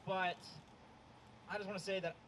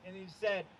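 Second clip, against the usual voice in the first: ordinary delivery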